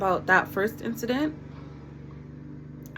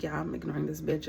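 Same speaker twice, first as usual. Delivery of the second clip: inner thought; 5 semitones lower, 6 dB quieter than usual. Retold or imitated. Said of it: "In my head, I'm like".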